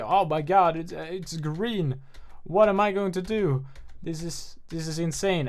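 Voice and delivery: upset Finn. Retold or imitated. Mocking Finn upset that his lightsaber turned green, high and panicky. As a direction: wail it like the sky is falling.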